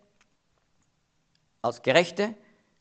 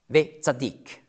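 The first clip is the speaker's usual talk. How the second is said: ordinary talk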